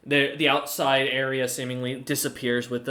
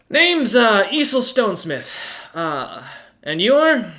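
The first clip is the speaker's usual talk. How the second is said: higher, louder friendly merchant voice; dwarf banker voice; 6 semitones higher, 5 dB louder than usual. Acted. Dwarf banker greeting the party, higher and friendly. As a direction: greet them brightly as the snow dwarf. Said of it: "the individual smiles and goes".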